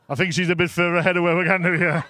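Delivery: usual speaking voice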